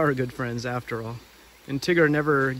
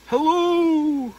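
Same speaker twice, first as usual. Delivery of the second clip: high loud call; cheery Tigger shout; Tigger hello; 14 semitones higher, 7 dB louder than usual. Acted. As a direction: hail him from afar as Tigger. Tigger calling out in story.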